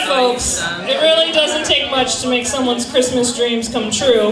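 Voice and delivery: usual speaking voice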